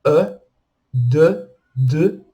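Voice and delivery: "E" and "D" as rounded eu, deux; French pouted vowel. Shown as a demonstration. Teacher recapping the pouted sounds, pronunciation demo. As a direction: pout the lips on E, D. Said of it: "o biquinho".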